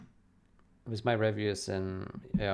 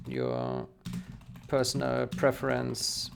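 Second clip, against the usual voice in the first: slow, halting dictation voice, word by word; dictating; 4 semitones lower, 7 dB quieter than usual. Real, not acted. Developer dictating a reply to a reviewer, slow and halting. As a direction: spell out a reply to a speech-to-text app, pausing often.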